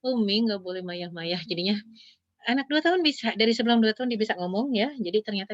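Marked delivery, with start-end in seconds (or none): 0.0-1.4 put-on slow toddler talk, "mayah-mayah" with a y sound; toddler mispronunciation. Child pleading with her mother, in slow toddler talk. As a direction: imitate a toddler saying "Umi, nggak boleh mayah-mayah". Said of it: "sering saya panggil Mayah".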